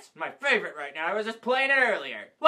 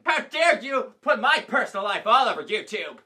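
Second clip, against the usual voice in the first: ordinary delivery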